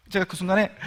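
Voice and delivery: usual speaking voice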